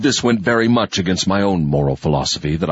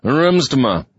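muffled; 7 semitones higher than usual. A narrator's reading. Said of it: "I said in a muffled voice".

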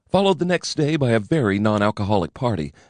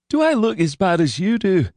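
hoarse voice; 9 semitones higher than usual. A narrator's reading. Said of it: "Floyd said in a hoarse voice".

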